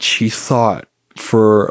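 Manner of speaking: ordinary delivery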